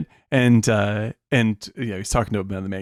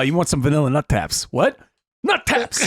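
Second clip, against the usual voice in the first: put-on Boston accent; 3 semitones higher than usual. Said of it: "the Dunkin' Donuts".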